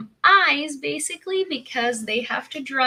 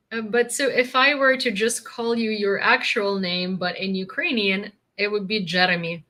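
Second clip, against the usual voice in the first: ordinary talk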